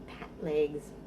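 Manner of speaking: ordinary talk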